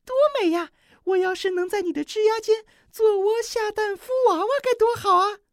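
极高极响的惊叹; 尖声赞叹，惊喜万分; 惊奇的小黄雀. A narrator's reading. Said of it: "惊奇地说". (小黄雀尖声惊叹)

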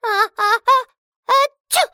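about to sneeze. Acted. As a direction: stretch the ahs, then sneeze. Sneezing dwarf.

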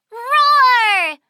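loud mock roar; a hammed-up bubble monster; playful monster roar. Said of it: "I am a bubble monster".

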